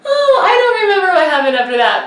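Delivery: real laughter through the words; laughing. Real, not acted. Vlogger cracking up at her husband's answer, real laughter. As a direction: laugh so hard the words barely come out.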